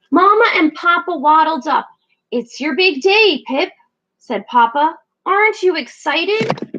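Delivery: a hint of a cheerful penguin dad; bright, eager proud father; lightly voiced Papa penguin. Papa penguin greeting his chick on her big day.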